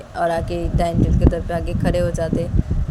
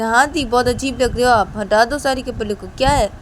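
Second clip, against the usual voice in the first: higher, louder teasing; 5 semitones higher, 4 dB louder than usual. Acted. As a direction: egg her on. Ravi teases sister.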